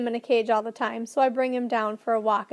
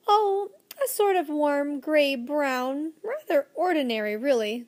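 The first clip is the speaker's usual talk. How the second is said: put-on old professor; 4 semitones higher, slower than usual. Professor answering as character.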